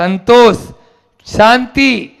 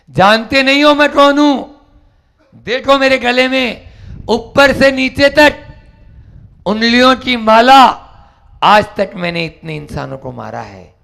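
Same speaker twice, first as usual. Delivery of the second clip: loud, higher bandit threat; menacing boast; 5 semitones higher, 4 dB louder than usual. Acted. Bandit threatening the calm monk, loud boast. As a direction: threaten him proudly.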